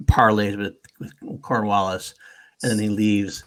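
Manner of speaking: ordinary delivery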